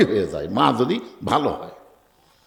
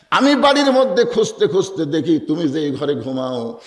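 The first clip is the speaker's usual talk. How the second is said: normal talking voice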